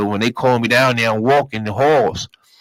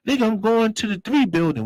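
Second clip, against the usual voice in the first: defiant; 9 semitones higher than usual. Retold or imitated. Answering the guards.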